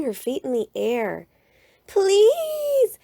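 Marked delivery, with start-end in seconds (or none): 1.9-3.0 very high, loud pleading cry; loud begging shout. A narrator's reading. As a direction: scream please for the whole lot to hear. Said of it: "shouted the cubs so loudly".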